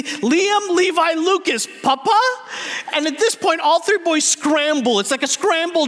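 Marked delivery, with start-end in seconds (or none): 0.0-2.2 high, urgent calling out; mock yell summoning everyone, pitched up; retold panicked yell. Panicking parents calling their three boys, mock high yell. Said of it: "we all yell simultaneously".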